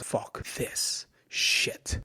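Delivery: slow, hushed and voiceless; a drawn-out, whispered curse of surrender; defeated inner thought. Retold or imitated. Wrestler telling himself he quits mid-match, hushed and defeated.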